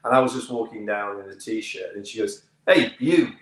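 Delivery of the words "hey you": loud, higher South African call; South African accent. Retold or imitated. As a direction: hail someone sharply in a put-on South African accent. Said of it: "forgive my accent here".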